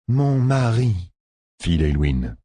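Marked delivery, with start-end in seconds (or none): 0.1-0.9 higher foreign woman, fond; a hint of a teasing wife; Leilwin's voice. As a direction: address your husband. Leilwin addresses Domon.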